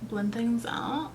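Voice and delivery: mock valley girl "out?", slow and rising. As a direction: drawl "Blend things out?" valley girl style. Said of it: "I said out like a valley girl".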